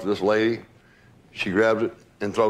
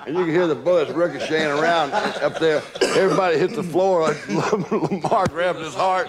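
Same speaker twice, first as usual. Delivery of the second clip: real laughter breaking through the words; laughing while telling; 5 semitones higher than usual. Real, not acted. Old friend laughing as he recalls the bullets flying. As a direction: chuckle your way through the memory.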